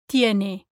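"tiene" with tongue touching the back of top teeth; soft dental T in "tiene", no puff of air; soft Spanish T. Shown as a demonstration. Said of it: "with your tongue touching the back".

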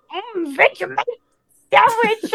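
playful high-pitched regal impression; the Queen. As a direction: mimic a monarch in a high, light voice. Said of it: "when she was like".

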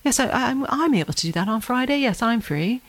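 put-on British accent, lifted and halting at first. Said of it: "he has this great British accent".

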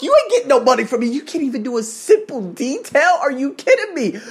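high, indignant rant; incredulous scolding, pitched well up; mad as fuck. Retold or imitated. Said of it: "I was mad as fuck".

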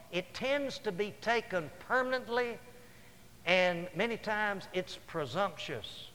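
ordinary delivery

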